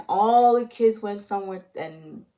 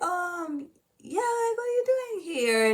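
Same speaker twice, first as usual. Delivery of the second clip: much higher, held high pitch; high-pitched retold reply; 12 semitones higher than usual. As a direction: imitate a character in a high, drawn-up voice. Acts out a startled character's reply.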